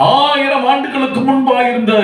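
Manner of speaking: scornfully quoting the board; mocking the sign. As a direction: sneer at the sign's claim with a laugh. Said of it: "அப்படின்னு போடுறான் board".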